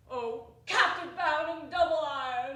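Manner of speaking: loud, high lament; anguished cry. Acted. Ghost lamenting his chains to Scrooge, a loud anguished cry. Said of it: "cried the phantom".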